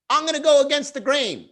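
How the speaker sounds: high, puffed-up declaration; mock defiant poster. Comedian mocking a poster going against the grain. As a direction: sound bravely pompous.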